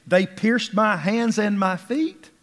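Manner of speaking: high, loud emphasis on key phrases; impassioned pulpit recitation in bursts; fervent scripture reading. Acted. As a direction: declaim the psalm, pitch leaping up. Reads the psalm aloud fervently.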